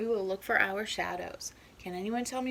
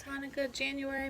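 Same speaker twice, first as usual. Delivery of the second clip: plain reading voice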